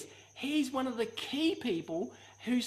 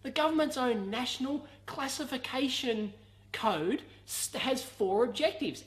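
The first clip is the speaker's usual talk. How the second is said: normal talking voice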